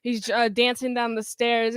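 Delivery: ordinary talk, nothing marked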